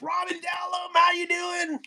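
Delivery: high, shouted mock greeting; sudden excited greeting. Waking at three to greet Robin, in a high mock shout. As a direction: burst out like a name just clicked. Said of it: "I'll be like".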